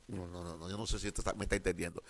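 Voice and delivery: dropped low and soft; hushed check-in with the congregation; quiet aside. Real, not acted. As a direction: lean in and ask them gently.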